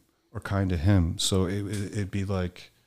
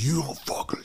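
higher-pitched imitation of a furious father; retold angry outburst; 7 semitones higher than usual. Son imitating his father's outburst as the table flips, mock fury. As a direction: snap it like a dad losing his temper at dinner.

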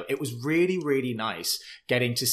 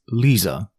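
Ken's Lisa; 3 semitones lower, 5 dB louder than usual. Retold or imitated. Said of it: "how he says Lisa".

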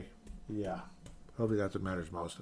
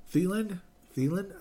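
incredulous; 9 semitones higher than usual. Real, not acted. Incredulous at re-signing.

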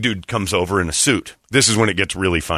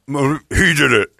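mouth full; 3 semitones higher than usual. Retold or imitated. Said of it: "Mouthful of food".

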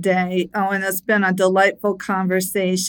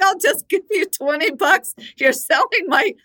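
quoting her, amused; 7 semitones higher than usual. Retold or imitated. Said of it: "She was like".

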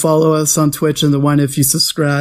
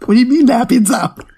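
incredulous; 8 semitones higher than usual. Retold or imitated. Dad startled by son's claim.

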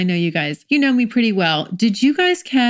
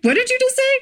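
mock-startled, climbing high into laughter; hammed-up confused waking, breaking into giggles; startled awake; 6 semitones higher than usual. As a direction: jolt out of sleep with rising questions. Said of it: "you startle the other person".

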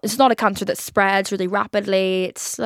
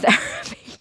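ordinary talk, nothing marked

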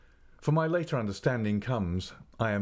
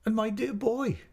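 high, emotional motherly exclamation; crying out. A narrator's reading. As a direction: cry out to a beloved child. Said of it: "cried my mother".

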